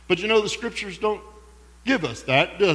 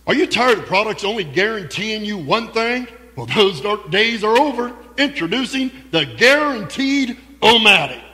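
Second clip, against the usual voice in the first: infomercial announcer; 3 semitones higher than usual. Pretend. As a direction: sell it like a late-night ad. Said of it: "It sounds like an infomercial".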